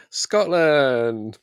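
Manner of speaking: big, loud, rising Scotland; announced Scotland. Pretend. Host leading a cheer for a trip, loud and bright. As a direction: belt out Scotland like a fanfare. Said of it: "say it with me".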